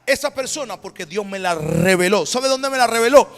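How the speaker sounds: loud, driving preacher's cry; fiery preaching. Acted. Pastor telling the congregation where God speaks, at full force.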